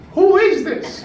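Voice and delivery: high, innocent question; sweetly confused. Acted. Old woman on the phone to her fiancé, high and clueless. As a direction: ask it high and clueless like a forgetful granny.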